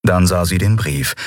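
ordinary delivery

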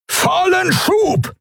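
very high, drawn-out yell; desperate bellowed command; roared command. A narrator's reading. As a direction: roar the order slowly, pitch way up. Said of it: "brüllte Thelios".

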